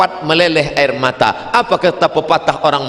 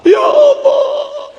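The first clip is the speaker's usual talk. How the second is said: very high, slow wail; long tearful Ya Allah; weeping cry; much slower than usual. Retold or imitated. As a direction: break down on it. Man finally weeping at Kaaba.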